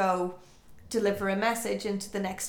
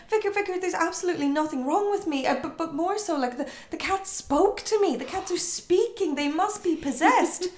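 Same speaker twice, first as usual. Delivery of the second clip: high, fast, desperate insisting; insistent James; 8 semitones higher, 3 dB louder than usual. Retold or imitated. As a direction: rush it out, begging to be believed.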